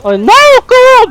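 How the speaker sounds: high, loud cry of dismay at the screen; exasperated cry. Real, not acted. Streamer to his viewers as the enemy returns, high loud dismay. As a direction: yelp it like the game just betrayed you. Frustrated gamer losing again.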